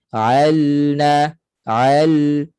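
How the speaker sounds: ALNA with L sounded and slightly held; flowing clear lam. Shown as a demonstration. Teacher models tawassut for students, clear flowing lam. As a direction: let the L of alna flow briefly before NA. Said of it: "kena mengalir sedikit suara".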